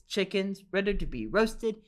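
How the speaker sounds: ordinary delivery, nothing marked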